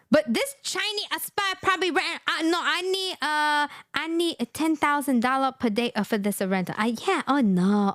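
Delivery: hammed-up caricature landlord bargaining; mock accent haggling over rent; mock Chinese accent. Voicing the woman, mock accent.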